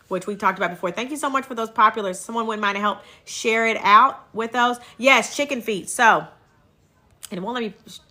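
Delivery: ordinary talk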